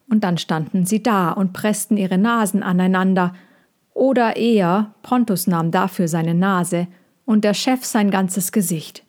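plain reading voice

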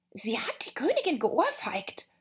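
high, hushed rabbit whisper; confiding whisper. Acted. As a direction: whisper scandalous gossip.

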